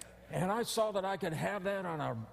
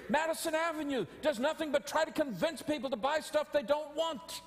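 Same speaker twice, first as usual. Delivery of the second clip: ordinary talk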